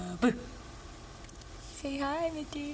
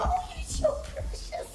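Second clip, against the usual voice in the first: put-on very high, slow baby talk; mother imitating her son's high, slow baby talk; brother's baby talk; slower than usual. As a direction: coo it like a big brother doting on the baby. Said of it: "He talks like".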